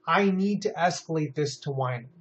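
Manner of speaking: ordinary talk, nothing marked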